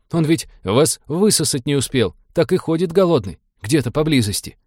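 high-pitched anxious lament; fearful wailing. A narrator's reading. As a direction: keep fretting in a high, pleading voice. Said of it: "запричитал Фрэнк".

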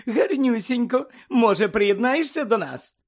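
high, sugary coaxing; gushing exclamation. A narrator's reading. Reporter invites Harry to join them, gushing. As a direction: coo it high and drawn out, inviting. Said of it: "вигукнула вона, розквітнувши".

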